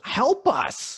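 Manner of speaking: high urgent cry for help; desperate plea. Acted. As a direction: beg urgently. Trapped, begging.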